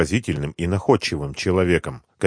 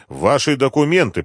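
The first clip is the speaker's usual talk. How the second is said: higher-pitched insistent demand; настойчиво; 6 semitones higher, 4 dB louder than usual. A narrator's reading. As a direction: press the demand with authority. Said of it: "продолжал настаивать капитан". (настойчивый требовательный окрик)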